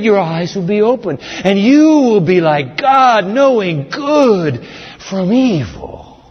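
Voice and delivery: high, drawn-out sly serpent voice; slow, seductive serpent lie; serpent's promise. Acted. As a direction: stretch every word like a tempter. Serpent's line in scripture.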